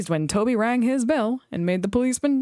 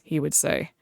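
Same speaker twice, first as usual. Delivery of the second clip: plain reading voice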